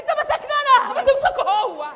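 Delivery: indignant shout. Acted. Protests at being held back.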